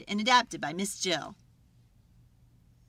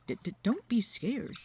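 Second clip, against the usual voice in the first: little voice; 2 semitones higher than usual. Acted. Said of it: "a little voice said".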